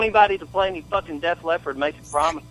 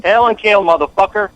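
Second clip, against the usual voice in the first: shouted sign-off; 6 semitones higher, 5 dB louder than usual. Real, not acted. Caller signs off with war cry.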